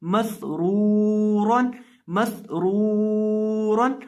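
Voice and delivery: slow, drawn-out Quranic recitation of मसरूरन; recited slowly. Shown as a demonstration. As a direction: chant मसरूरन slowly with the nunation. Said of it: "इसको पहले पढ़ के देखते हैं". (मसरूरन धीमी तिलावत में)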